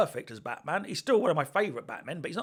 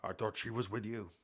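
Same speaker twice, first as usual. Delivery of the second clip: low, soft distorted Batman voice; a hint of voice distortion; Affleck voice distortion; 7 semitones lower, 10 dB quieter than usual. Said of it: "A kind of a voice distortion thing".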